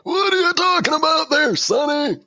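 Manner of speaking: put-on high quavering old geezer voice; old man voice. Mocking old man voice back.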